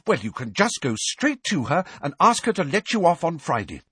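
high, fierce, cutting order. A narrator's reading. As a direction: snap it at him fiercely. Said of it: "said Angelina fiercely".

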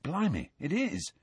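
soft, hushed disbelief; quiet. A narrator's reading. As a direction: say it under your breath. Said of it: "said Ron quietly".

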